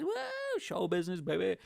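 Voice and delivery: high whooping showbiz call; showman hype. Pretend. Stage entrance hype call.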